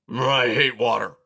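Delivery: loud, sulky declaration; a cranky porcupine grumbling at the rain; grumpy porcupine. A narrator's reading. Narrator voicing Aerith, complaining to nobody about the rain. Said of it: "Aerith proclaimed to nobody in particular".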